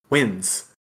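ordinary talk, nothing marked

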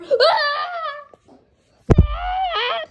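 very high cartoon shriek; high scream. Mock milk recipe goes wrong, high shrieking. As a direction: shriek out in repeated bursts.

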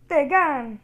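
loud triumphant call-out; excited shout. Pretend. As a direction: shout the weapon's name with relish. Calls out new weapon's name.

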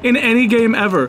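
high, helpless laughter; laughing too hard to speak normally; cracking up. Real, not acted. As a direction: talk through a big laugh. Cracking up at game callout.

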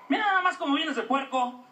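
a hint of a scolding mother; scolding mom voice. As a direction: nag like an exasperated mother.

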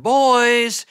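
a high, loud yell of a name; hollered. Retold or imitated. Father hollers for his hiding sons, loud yell. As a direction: holler for the boys. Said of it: "our daddy hollered".

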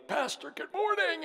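high-pitched imitation of a hearty greeting; affectionate high-voiced impersonation; Doug. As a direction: mimic a friend's greeting in a high voice. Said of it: "I can remember Doug coming in".

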